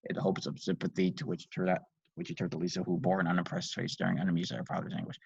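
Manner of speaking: ordinary delivery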